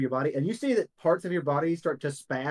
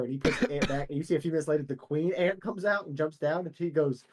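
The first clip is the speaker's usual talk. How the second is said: ordinary talk, nothing marked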